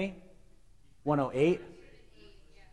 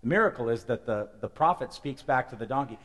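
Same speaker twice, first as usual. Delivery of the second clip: ordinary talk, nothing marked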